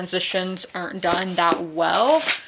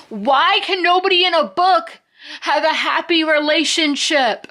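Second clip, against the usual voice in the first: loud, high-pitched exasperated rant; mad dog outburst; 8 semitones higher, 5 dB louder than usual. Real, not acted. Annoyed reader venting about book couples, mad dog outburst. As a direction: explode in exasperation, much higher and louder. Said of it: "Sorry for my mad dog moment there".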